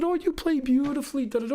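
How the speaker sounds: put-on high, sweet old-lady greeting; sweet elderly lady. Narrator imitates the kind Steinway store lady greeting him. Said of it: "And she said".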